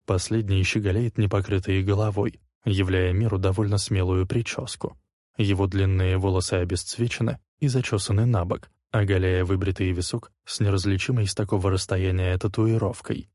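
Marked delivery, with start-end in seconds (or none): none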